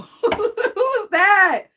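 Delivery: laughing shout. Real, not acted. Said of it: "it must be a channeling day".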